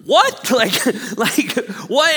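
a very high, loud mock what; hammed-up bewildered what; baffled. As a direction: squeak out in confusion. Mock baffled reaction to parable.

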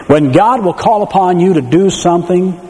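ordinary talk

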